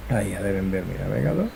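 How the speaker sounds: usual speaking voice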